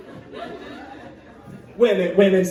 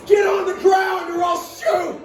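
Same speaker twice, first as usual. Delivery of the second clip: robber's shout; 10 semitones higher than usual. Pretend. Robber repeating his order to the hostage, mock yell. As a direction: yell the order again, angrier.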